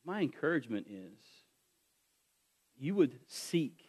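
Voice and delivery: tender pastoral invitation; gentle appeal. Acted. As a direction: ease down and plead gently. Soft closing appeal.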